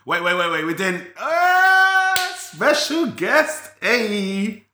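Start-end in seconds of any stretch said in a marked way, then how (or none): 1.2-4.6 high drawn-out squeal of welcome; big, sing-out hello for a little guest; excited greeting. Real, not acted. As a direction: stretch it out high to hail a special guest. Greeting a child who appears on stream.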